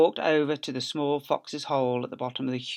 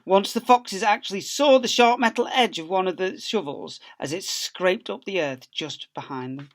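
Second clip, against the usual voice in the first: ordinary delivery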